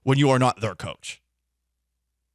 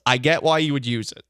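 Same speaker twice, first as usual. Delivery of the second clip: usual speaking voice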